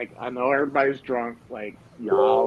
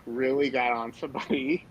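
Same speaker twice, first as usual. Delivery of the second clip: ordinary delivery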